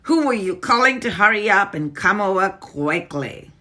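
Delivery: high, loud, sharp witch snap; Grand High Witch snapping in suspicion. Acted. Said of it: "snapped the Grand High Witch".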